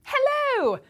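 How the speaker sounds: bright, loud British-accent hello; English accent. Shown as a demonstration. A correspondent greets viewers in her English accent. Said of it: "talking like this on the telly".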